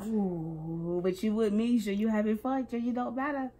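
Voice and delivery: usual speaking voice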